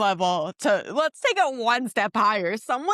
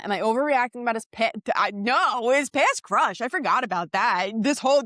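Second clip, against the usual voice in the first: ordinary delivery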